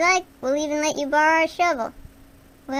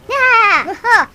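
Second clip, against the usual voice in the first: sudden loud, high scream of fright; startled scream; 7 semitones higher, 12 dB louder than usual. Real, not acted. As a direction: jump and yelp in shock. Startled in the dark kitchen.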